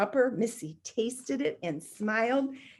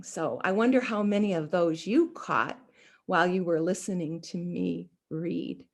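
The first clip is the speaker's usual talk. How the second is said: ordinary delivery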